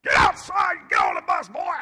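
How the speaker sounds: bellowed drill-chief order, high and loud; loud chief shout. Retold or imitated. Chief yelling at recruits off the plane, loud shout. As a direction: bark it like a big navy chief. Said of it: "and he is loud".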